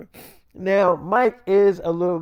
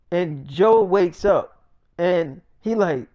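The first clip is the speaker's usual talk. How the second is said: ordinary delivery, nothing marked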